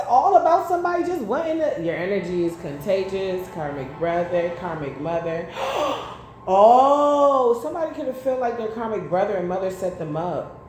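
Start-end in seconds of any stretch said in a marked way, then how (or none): 5.5-6.2 sharp gasp. Real, not acted. Reacting to drawn card.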